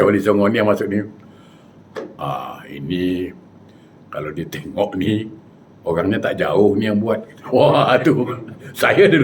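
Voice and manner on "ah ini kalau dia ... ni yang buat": low, slow, soft mock trance voice; drawn-out, low pronouncement from a trance; possessed healer. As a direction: imitate a spirit medium pondering slowly. Voicing the possessed healer's verdict.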